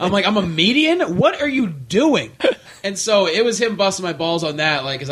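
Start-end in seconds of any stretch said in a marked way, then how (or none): 0.3-2.3 higher, drawn-out baffled retort; mock exasperation. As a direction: act out bewilderment at a garbled text. Retold baffled reply to friend's text.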